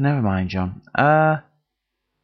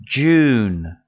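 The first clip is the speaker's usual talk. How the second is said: slow, loud drilled "June." with falling tune; teacher modelling; 2 semitones lower, 4 dB louder than usual. Shown as a demonstration. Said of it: "Now listen carefully".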